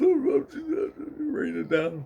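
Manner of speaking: very high, slow put-on wail; mock crying. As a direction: imitate crying while trying not to laugh. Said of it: "He's crying".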